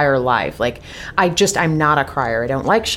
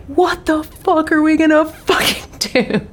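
high, slow mock wail; Aiden-style freakout; 12 semitones higher than usual. Wife re-enacting her reaction to losing the buyer, mock freakout. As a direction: drag out each word in pitched-up exasperation. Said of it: "And I was like, "Aiden".

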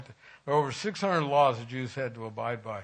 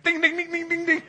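high, louder sing-song ding dings; imitated bell ringing; 7 dB louder than usual. Husband imitating his wife ringing the bell, playful high dings. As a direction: ring out like a wind chime in playful mock. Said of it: "she went".

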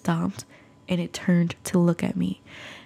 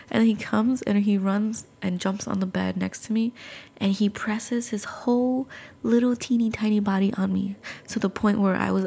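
ordinary delivery

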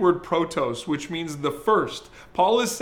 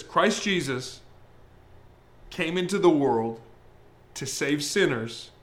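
solemn scripture reading; 4 semitones lower than usual. Shown as a demonstration. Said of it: "That's the quote right there".

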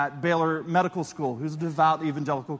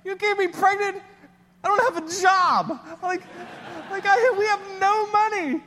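much higher, louder frantic rush of words; panicked disbelief; 4 dB louder than usual. Retold or imitated. To his wife on the phone, frantic disbelief. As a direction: spiral into panic at the news. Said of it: "I'm like".

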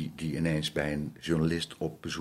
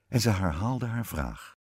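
ordinary delivery

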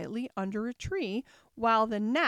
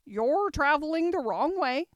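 higher, louder guard voice; guard's pronouncement; 6 semitones higher, 7 dB louder than usual. A narrator's reading. Train guard to a girl at the window, loud pronouncement. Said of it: "at last he said".

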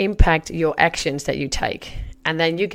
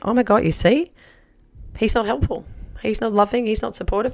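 higher-pitched, fed-up mock rant; exasperated complaint; 6 semitones higher than usual. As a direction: play your old self fed up with him. Retold exasperated self complaining.

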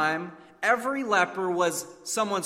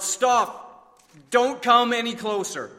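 loud, higher desperate yell; 7 semitones higher, 7 dB louder, slower than usual. Acted. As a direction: shout them back before they reach you. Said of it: "when he yelled out".

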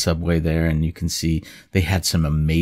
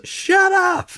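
mock yell. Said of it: "somebody will say".

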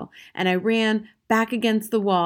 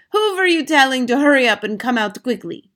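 loud, high witch interrogation; suspicious villainess, voice raised; snapped; 6 semitones higher, 7 dB louder than usual. A narrator's reading. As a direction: demand an answer crossly. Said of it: "snapped the Grand High Witch".

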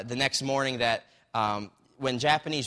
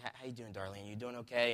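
much softer, almost under the breath; tender near-whisper of concern; hushed, tender greeting; 11 dB quieter than usual. Retold or imitated. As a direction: ask gently so nobody else hears. Friend quietly greets prisoner.

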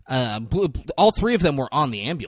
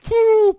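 high whooshing rocket launch; bazooka whoosh; 9 dB louder than usual. Retold or imitated. Someone shoots Charlie with a bazooka, imitated whoosh.

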